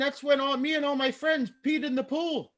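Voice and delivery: high, put-on German accent; bad German accent. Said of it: "My bad German accent".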